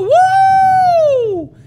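long, high, loud whoop; big woo. Shown as a demonstration. Player showing a planned cheer, high whoop. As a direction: let out a drawn-out cheer. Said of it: "I was gonna go".

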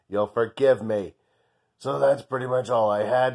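normal talking voice

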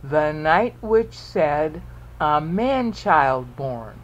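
very slow, evenly spaced verse; counting out syllables. Shown as a demonstration. Teacher shows another eight syllables, slowly measured out. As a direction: draw out each syllable so it can be counted. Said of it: "Another eight syllables".

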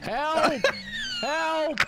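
loud, high cry for help; calling for help. Acted. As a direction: yell out for help.

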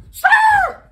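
a sharp, very high squeal like tires; imitated tire screech. Peeling off the scene, mimics squealing tires. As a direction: screech it out like a car skidding away.

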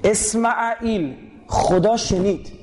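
اسمعیل drawn out slowly, then خدا شنید; teacher unpacking a name slowly; slow etymology. Shown as a demonstration. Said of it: "اصلاً اسماعیل یعنی چی؟".

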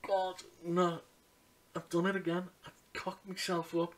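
mildly despairing, kicking yourself; retold dismay. Care worker to himself after the telling-off, dismayed.

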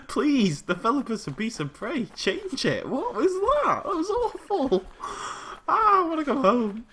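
high, rattled whine after a scare; distressed pleading. Real, not acted. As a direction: plead pitifully, pitched well up.

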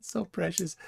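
high, soft condescending coo; mock adoring. Cohost mocks flat earther's claim, soft mock coo. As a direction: softly echo the fake fondness.

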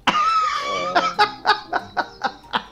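very high cackling laugh; high squealing laugh. Real, not acted. Gamer squealing with laughter at the angry opponent.

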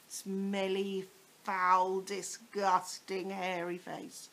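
slow, savoured revolted words; relishing disgust. Acted. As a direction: linger on every gross word.

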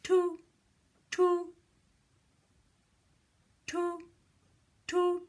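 high, short horn toots; crisp imitation of a horn. Shown as a demonstration. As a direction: toot on one held high note like a little horn. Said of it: "What does a horn say?".

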